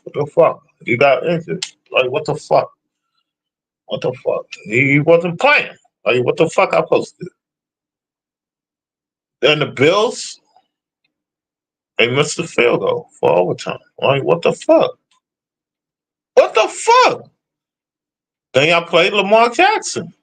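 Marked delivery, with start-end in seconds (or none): 3.9-4.5 breathy whispered disbelief under the breath; whispered what the fuck. Real, not acted. Griping about Joe Burrow's injury, whispered disbelief. 16.3-17.3 much higher, squeaky exasperated cry; squeaky what the fuck. Real, not acted. Griping about the Bills' missed field goal, high squeaky disbelief.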